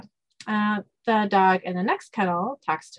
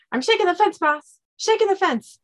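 high, eager comic dog voice; excited dog; 11 semitones higher, 3 dB louder than usual. Acted. Kennel dog reporting to the boss, excited. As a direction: report it urgently in a pitched-up voice.

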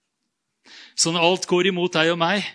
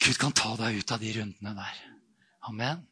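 softer, lower, gentle promise; reassuring; 6 semitones lower, 7 dB quieter than usual. Real, not acted. Preacher reassuring the congregation, softer and lower. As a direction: let it land quietly.

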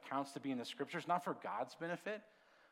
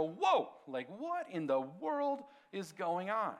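retold shock; 4 semitones higher, 4 dB louder, slower than usual. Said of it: "made me stop and go".

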